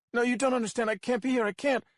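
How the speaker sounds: high, loud desperate denial; refusing in rising panic; panicked protest. A narrator's reading. Frightened captive insists to her captor, desperate.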